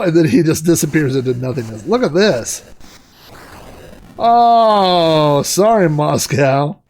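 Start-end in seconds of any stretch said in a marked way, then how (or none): none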